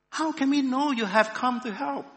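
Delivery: high, loud questioning cry; wary challenge. A narrator's reading. Frightened cave people questioning the stranger who offers help, wary challenge.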